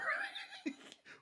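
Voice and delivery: a very high, squealing laugh; high laughter. Real, not acted. Laughing at the Barbie game.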